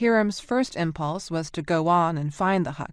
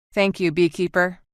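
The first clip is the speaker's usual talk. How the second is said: ordinary delivery, nothing marked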